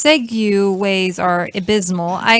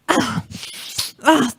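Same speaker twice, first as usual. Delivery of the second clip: normal talking voice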